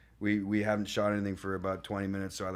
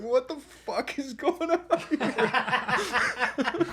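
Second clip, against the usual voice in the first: slow, much higher laughing disbelief; bewildered; 5 dB louder, much slower than usual. Retold or imitated. New actor stunned after the take, laughing, high and slow. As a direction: stretch it out, utterly lost. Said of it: "And I was just like".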